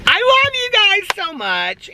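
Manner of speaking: very high, drawn-out affectionate squeal; gushing squeal. Real, not acted. Vlogger signing off to viewers, gushing high squeal. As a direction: squeal your love, slowly.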